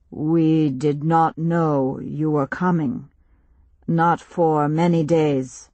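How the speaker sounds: slow, grave stranger voice; slow solemn chief. Acted. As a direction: speak slowly like a village elder.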